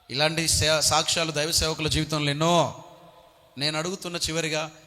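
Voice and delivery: ordinary talk, nothing marked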